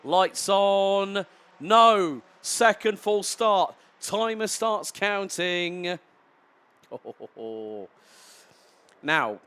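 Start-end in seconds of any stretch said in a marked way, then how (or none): 0.0-6.0 rising, loud, slowing race-start call; excitable commentary. Real, not acted. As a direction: build the tension as the lights go out. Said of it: "I did have an excitable tone".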